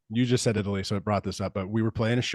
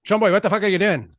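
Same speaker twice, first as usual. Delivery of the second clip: higher, louder Glaswegian imitation; Glasgow accent; 7 semitones higher, 7 dB louder than usual. Retelling a hard-to-follow local's greeting, imitated Glasgow accent. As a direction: run it together in mock Glasgow slang. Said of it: "That sounds like Glasgow".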